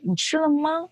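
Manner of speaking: 你吃了吗 pitched high with a rise; rising question tune. Shown as a demonstration. Teacher showing rising question intonation, high 你吃了吗. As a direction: lift 你吃了吗 well up at the end. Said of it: "the rising intonations like".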